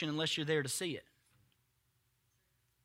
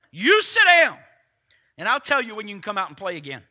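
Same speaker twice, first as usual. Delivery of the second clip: high, loud order, then fast; scolding parent; 4 semitones higher, 12 dB louder than usual. Acted. Jesus putting the storm in timeout, scolding. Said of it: "put the storm in timeout".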